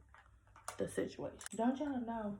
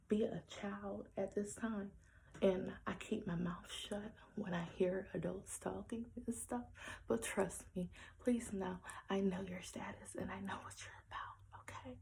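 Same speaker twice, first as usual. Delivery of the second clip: ordinary talk, nothing marked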